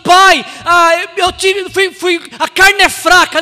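high, loud stammered excuse; making excuses. Acted. Son excusing his sin as weak flesh, stammered loud excuse. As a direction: fumble loudly for a justification. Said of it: "Ele não fala assim".

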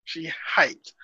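teasing whispered aside. Real, not acted. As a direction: whisper it with a grin. Teasing aside about guest.

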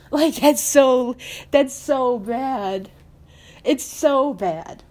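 slow, stretched lament; drawn-out dismay. Real, not acted. Fan bemoaning how the team lost, drawn-out dismay. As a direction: drag the words out in disbelief.

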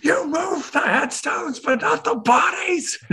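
high, drawn-out movie yell impression; mock anguished high yelling; Poltergeist guy yell. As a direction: imitate an anguished film outburst, strained and slow. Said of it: "one more yell, uh, guy from the Poltergeist".

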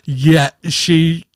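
ordinary talk, nothing marked

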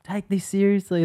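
boss yelling. Retold or imitated. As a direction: yell at the boys like an angry boss. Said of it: "yelling out to him".

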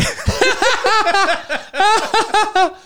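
big burst of high laughter; a real laughing fit; loud laugh. Real, not acted. Laughs at own story.

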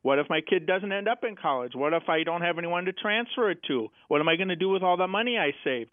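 ordinary delivery

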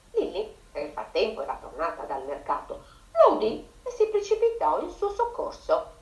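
plain reading voice

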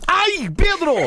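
high yelp of complaint; pained protest. Real, not acted. Slapped by cohost in the game, high pained protest. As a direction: yelp at the friend who hit you.

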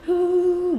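high drawn-out wailing "huu"; mock sobbing wail; imitated crying. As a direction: mimic a wail, held high. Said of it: "kayak nangis-nangis".

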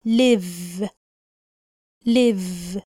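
live with a short vowel and a clear v. Shown as a demonstration. Teacher modelling live. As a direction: end live on a voiced v. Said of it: "وبننطقها live".

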